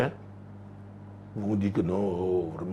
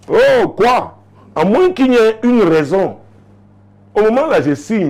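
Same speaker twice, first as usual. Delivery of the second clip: much higher, louder, laughing disbelief; excited teasing; 12 semitones higher, 7 dB louder than usual. Real, not acted. Preacher to the husband, loud teasing disbelief. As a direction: tease him with exaggerated shock.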